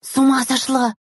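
high, tense, voiced stage whisper; frightened whisper. A narrator's reading. Girl afraid the teacher will hear, frightened. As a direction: squeal it in alarm. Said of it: "испуганно вытрящив глаза, зашептала Тикаева".

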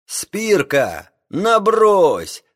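high, loud call; shouting through megaphone. A narrator's reading. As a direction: bellow through the speaking trumpet. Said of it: "закричал в рупор капитан".

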